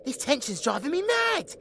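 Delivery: high, fast exasperated complaint; rapid, high-pitched impatience; impatient whine. Acted. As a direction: burst out whining, sick of waiting. Girl impatient with the wait.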